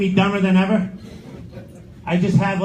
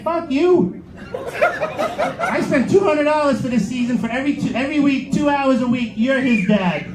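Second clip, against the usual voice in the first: mock snapping at coach; 6 semitones higher than usual.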